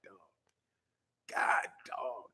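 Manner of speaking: breathless whisper of awe; whispered admiration. Retold or imitated. Ogling her legs, a stunned breathless whisper. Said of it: "I was looking at them legs like".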